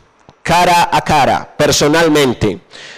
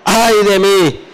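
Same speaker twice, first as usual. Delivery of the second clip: slow, drawn-out exclamation, mildly raised; exclaimed lament; 2 semitones higher, slower than usual. Acted. Preacher quoting Isaiah before God, mildly exclaimed lament. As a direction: cry out the woe in measured preaching voice. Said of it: "Entonces yo exclamé".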